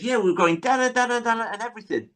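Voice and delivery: high, fast mock protest; imitating Rodney. Rodney protesting to Boycie, high and fast. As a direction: rattle it off high and quick like a flustered younger brother. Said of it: "And Rodney says".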